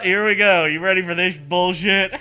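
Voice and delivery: ordinary talk, nothing marked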